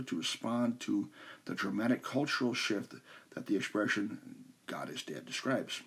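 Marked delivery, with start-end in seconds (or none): 4.7-5.2 low hushed. Shown as a demonstration. Quoted phrase, dropped low.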